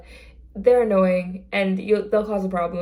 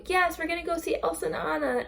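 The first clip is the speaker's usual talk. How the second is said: imitating little girl; 9 semitones higher, 3 dB quieter than usual. Child's reply voiced.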